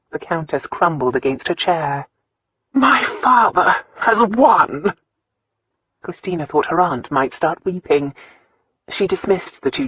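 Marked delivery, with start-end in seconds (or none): 2.7-4.9 high, very slow, stricken delivery; crushed defeat. A narrator's reading. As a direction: draw it out in bitter defeat. Countess crumbles in defeat.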